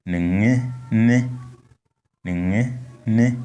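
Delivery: nenge ne with high tones on e. Shown as a demonstration. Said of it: "So the pronunciation is".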